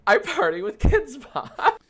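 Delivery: high, slow, laughing through the lyric. Real, not acted. Streamer reading a silly lyric, laughing. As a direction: crack up while reading the line.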